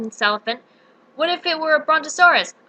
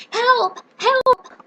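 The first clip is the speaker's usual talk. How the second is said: very high, louder, rising screams; screams for help; 10 semitones higher, 5 dB louder than usual. Acted. Trapped girl calling for rescue, shrieking higher.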